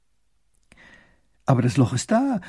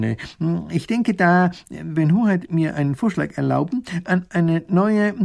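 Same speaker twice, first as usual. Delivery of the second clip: halting official voice, higher and louder, full of ums; hesitant minister; 4 semitones higher, 5 dB louder than usual. Acted. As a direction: stumble through the report like a nervous official.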